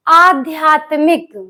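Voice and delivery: slow, deliberate आध्यात्मिक, each syllable clear; slow teacher model of आध्यात्मिक; careful spelling-out. Shown as a demonstration. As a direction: sound out आध्यात्मिक slowly like a teacher. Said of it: "word आप बोलिए अपने मुख से".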